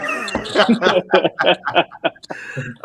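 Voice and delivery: high, loud burst of laughing; amused laughter. Real, not acted. Host hearing the fan's bold claim, loud laughter.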